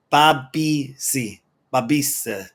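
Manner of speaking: "Bobby C" sounded out slowly, unsure; struggling to pronounce. Real, not acted. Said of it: "I can't pronounce".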